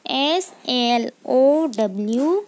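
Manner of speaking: each letter spaced out slowly; slow dictation of letters; slow spelling. Shown as a demonstration. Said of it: "teacher spells word for class".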